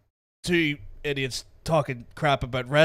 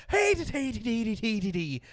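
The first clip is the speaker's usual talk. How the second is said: Dublin Irish; 6 semitones higher than usual. Retold or imitated. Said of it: "Dublin Irish is".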